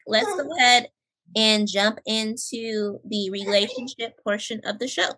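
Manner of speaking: normal talking voice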